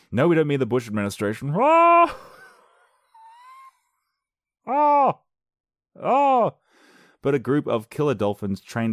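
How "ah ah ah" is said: very high, loud hammed-up shrieks; mock screams. Mocking a news joke with hammed-up screams.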